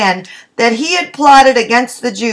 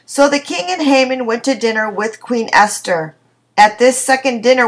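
ordinary talk

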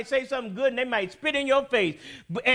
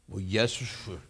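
mock polite reply; 6 dB quieter than usual. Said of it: "his answer in the retold scene".

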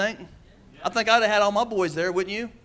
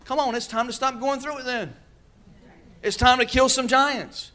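full-force preaching; 7 semitones higher than usual. Real, not acted. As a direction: preach it up to the rafters.

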